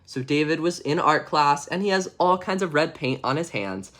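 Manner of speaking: ordinary delivery, nothing marked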